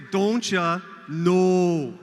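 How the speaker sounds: don't ya leaned into, then a punchy loud no; Bold North flair. Shown as a demonstration. Host showing the crowd the chant with flair. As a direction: give don't ya swagger, then slam the no. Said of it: "It's gotta be Bold North-like".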